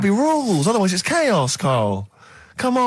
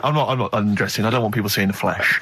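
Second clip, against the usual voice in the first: lower, prim little voice of Carl; imagined Carl; 8 semitones lower than usual. Retold or imitated. Imagining Carl at the beach, prim and lower. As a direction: play him as a prim prude refusing to strip. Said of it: "I imagine Carl sort of".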